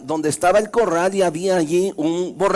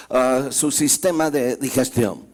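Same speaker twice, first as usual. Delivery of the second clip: ordinary delivery, nothing marked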